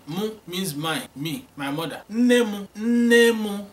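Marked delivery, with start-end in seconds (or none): 2.1-3.6 Nne mụ said higher and louder; emphatic model. Shown as a demonstration. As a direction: call Nne mụ out clearly. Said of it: "Say it".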